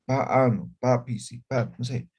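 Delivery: ordinary talk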